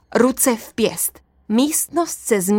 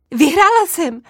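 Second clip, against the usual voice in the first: triumphant; 7 semitones higher, 3 dB louder than usual. Acted. Princess wins the game.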